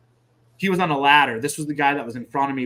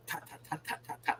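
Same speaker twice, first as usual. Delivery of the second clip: very soft, whispered rat-a-tat; imitated bullet impacts; 5 semitones higher, 15 dB quieter than usual. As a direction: mimic bullets peppering the ground under your breath. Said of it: "ground getting fucking peppered".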